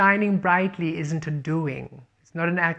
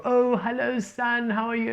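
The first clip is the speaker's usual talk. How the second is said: much higher, sing-song little greeting voice; mock polite greeting; 8 semitones higher than usual. Reader showing the sun doesn't ask permission, high mock chirp. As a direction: pipe up like a silly polite caller.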